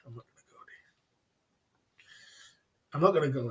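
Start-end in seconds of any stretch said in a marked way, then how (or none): 0.1-2.0 hushed whisper, barely voiced. Real, not acted. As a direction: say it under your breath. Muttered aside before repeating it.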